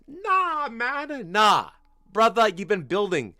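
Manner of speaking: high, wailed protest; exasperated refusal. Real, not acted. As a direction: wail it, dismissing the excuse out of hand.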